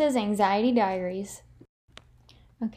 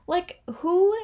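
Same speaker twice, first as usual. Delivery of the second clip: anguished whisper; 8 semitones higher, 5 dB louder than usual. Retold or imitated. Reliving breakdown in Florence.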